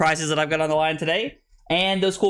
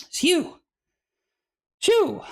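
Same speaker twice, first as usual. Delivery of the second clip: exhale; 14 semitones higher than usual. Real, not acted. Blowing out after coughing fit.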